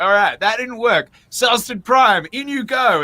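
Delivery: mock resigned gamer. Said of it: "I'm like".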